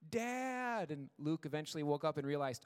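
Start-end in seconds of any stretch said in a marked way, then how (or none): 0.1-0.9 high, long-drawn call for Dad; imitated child's yell. Son calls Dad from the next room at night, imitated yell. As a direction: hold the word out high, imitating a kid yelling. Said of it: "he was yelling".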